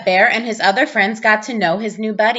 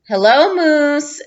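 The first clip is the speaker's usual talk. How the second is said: slow, higher, louder sing-song class greeting; in unison; 7 semitones higher, 5 dB louder, slower than usual. A narrator's reading. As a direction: chant it slowly together like a whole classroom. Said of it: "said the class in unison".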